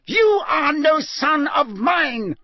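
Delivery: high, slow, furious bellow; enraged father bellowing. Acted. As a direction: disown him with a roar. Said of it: "bellowed Mister Crouch".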